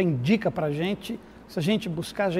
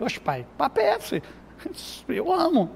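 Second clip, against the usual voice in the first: voz de adolescente imitada, bem mais aguda; filho adolescente; 7 semitones higher, 3 dB quieter than usual. Retold or imitated. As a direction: imite o filho surpreso, agudo e espantado. Filho adolescente responde ao pai. (pai imita o filho pré-adolescente respondendo, agudo e espantado)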